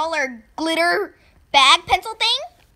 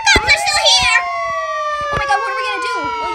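panicked shout; 9 dB louder than usual. Acted. Panic at cops.

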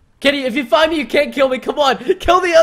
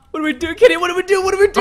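rising panic, higher and higher; panicked; 7 semitones higher, much faster than usual. Real, not acted. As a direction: ask it like you're trapped. Cornered by chasers.

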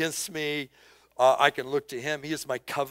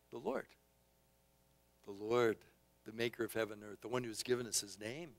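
quiet answer; 4 semitones lower, 11 dB quieter, faster than usual. Acted. Soft answer after question.

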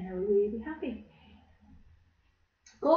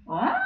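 elephant trumpet; 9 semitones higher than usual. Shown as a demonstration. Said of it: "nice long elephant trunk".

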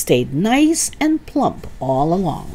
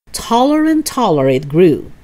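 usual speaking voice

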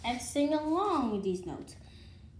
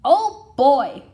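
excited; 15 dB louder than usual. Acted. Excited exclamation in story.

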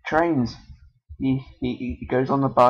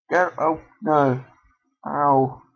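ordinary talk, nothing marked

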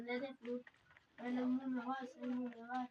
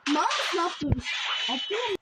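loud, high panicked yelling; alarmed shout; 5 semitones higher, 13 dB louder, slower than usual. Real, not acted. Frantic shout at teammate mid-fight. (panikle bağırarak)

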